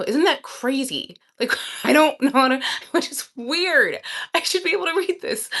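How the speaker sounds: high-pitched, incredulous outburst with a laugh; worked-up, squeaky exasperation; exasperated disbelief. Real, not acted. Exasperated at failing to read word.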